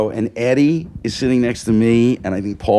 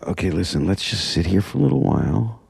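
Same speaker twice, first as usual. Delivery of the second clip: whispered plan; 6 semitones lower, 8 dB quieter than usual. Retold or imitated. Telling his friends at the play, whispering. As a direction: keep it hushed so nobody notices. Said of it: "I go".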